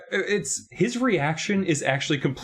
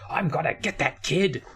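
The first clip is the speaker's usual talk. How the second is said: a light sneering Tim Curry villain voice; kids-movie villain voice; 6 semitones higher than usual. Retold or imitated. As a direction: play a hammy movie villain. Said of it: "Tim Curry from Home Alone Two".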